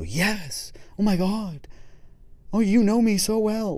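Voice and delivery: high, loud, gushing delight; gushing client. Retold or imitated. Mock client thrilled at being understood, gushing high. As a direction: squeal it like the therapist read your mind. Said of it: "the person's supposed to go".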